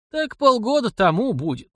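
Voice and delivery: put-on high-pitched, mildly astonished elderly voice; surprised old man. A narrator's reading. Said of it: "удивлённо прошептал старик".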